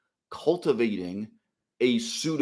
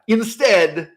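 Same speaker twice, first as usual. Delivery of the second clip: "Instead" punched out, louder and higher; loud emphatic; 9 semitones higher, 8 dB louder than usual. Real, not acted. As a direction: hit "Instead" hard, like slamming a door. Repeated word, first take.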